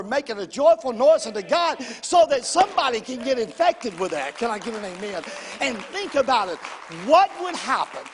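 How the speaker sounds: loud, rousing sermon delivery; fervent preaching. Real, not acted. Preacher urging America to make a joyful noise, fervent preaching. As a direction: drive it home to the whole room.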